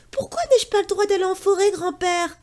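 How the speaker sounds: put-on high, quick child's voice pleading; a small boy begging his grandfather. Little Pierre begs grandfather to let him play, high and quick.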